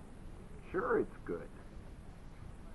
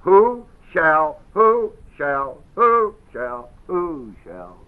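"who shall" repeated with WHO stressed; much slower than usual. Shown as a demonstration. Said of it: "Got it?".

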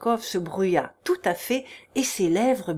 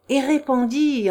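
ordinary delivery

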